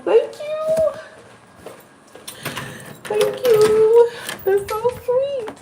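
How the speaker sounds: very high, squealy gratitude; gushing thanks. Real, not acted. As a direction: coo thanks to a fan in a tiny high voice. Gushing thanks to a fan's comment.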